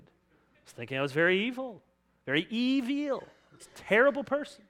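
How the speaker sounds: tongue-in-cheek confession, pitch climbing; sarcastic agreement. Acted. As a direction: gush in feigned agreement. Sarcastic agreement that one is evil.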